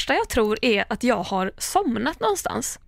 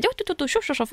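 brisk routine nurse; 7 semitones higher, faster than usual. Retold or imitated. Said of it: "och då bara så här".

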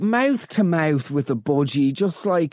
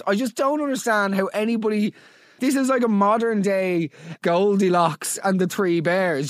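ordinary delivery

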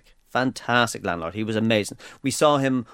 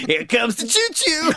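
high, drawn-out singsong; playful announcing; 10 semitones higher, slower than usual. Pretend. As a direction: announce the train like to a toddler. Joking about a passing freight train.

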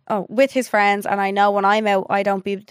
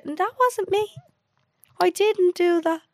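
mock denial voice; 10 semitones higher, slower than usual. Lads denying cheating, mocked.

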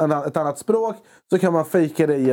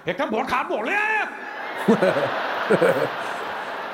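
angry fake Hindi rant; 5 semitones higher, 4 dB quieter than usual. Fake angry Hindi market outburst.

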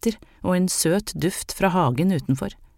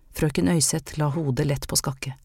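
usual speaking voice

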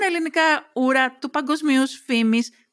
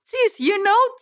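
giggly tipsy answer; 11 semitones higher, slower than usual. Acted. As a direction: chirp it out half drunk.

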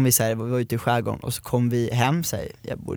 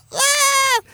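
high shriek, much higher; imitated sister's shriek. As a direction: shriek like a stung girl. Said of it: "börjar hon skrika".

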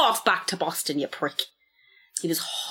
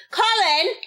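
calling out; 13 semitones higher, 4 dB louder than usual. Real, not acted. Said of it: "calling to Colin".

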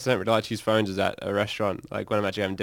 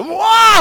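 high, loud excited scream; retold breaking scream; 13 dB louder than usual. Musician breaking in the middle of the restaurant, screaming. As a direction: burst out in a sudden high yell. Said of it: "I kind of broke a little bit".

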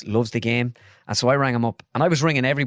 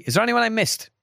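much higher call to the room; coach calling out; 11 semitones higher than usual. Retold or imitated. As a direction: call out to the squad. Manager calling roll.

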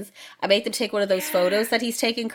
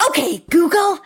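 high, loud, over-acted device greeting; self-conscious performer voice; 10 semitones higher, 6 dB louder than usual. Imitating Colin addressing Google like a person, loud showy greeting. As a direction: hail the speaker as if it were a person. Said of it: "like it's a person that's going to respond".

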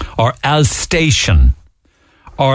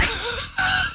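a real high laugh bursting out; burst of laughter; 12 dB quieter than usual. Real, not acted. Laughing at langer.

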